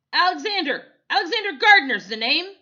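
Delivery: loud, high, fast bark. A narrator's reading. Photographer introducing himself, loud high bark. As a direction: announce the name sharply. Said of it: "he barked".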